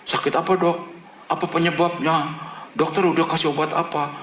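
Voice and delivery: high, insistent string of questions; interrogating questions. Retold or imitated. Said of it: "nanyanya kayak diinterogasi".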